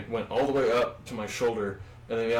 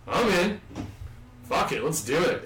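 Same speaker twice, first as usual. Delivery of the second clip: eager, raised yes to the cop; 5 semitones higher than usual. Retold or imitated. Telling the cop he's in, eagerly keen. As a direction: jump at the offer, pitched up. Eager reply to cop's offer.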